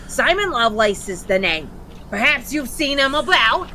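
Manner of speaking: cockney imp voice. Acted. Imp speaks in role.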